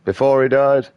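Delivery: imagined listener cuts in, mock-incredulous. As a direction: play the doubter who can't believe it. Imagined listener questions him.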